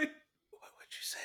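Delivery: slow unvoiced whisper. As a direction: whisper it slowly, menacing. Echoing the whispered line.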